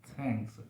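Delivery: थैंक्स softly, tongue tip at the teeth; low soft थैंक्स with a breathy th. Shown as a demonstration. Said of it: "तभी वो सही pronunciation होगा थ का".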